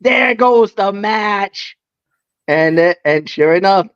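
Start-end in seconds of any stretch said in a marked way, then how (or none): 0.0-1.8 long wailed "no", then slow lament; dismayed outcry. Retold or imitated. Fan as Tiffany hit the ring, wailing dismay. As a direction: re-enact a commentator's drawn-out dismay. Re-enacts his commentary outcry.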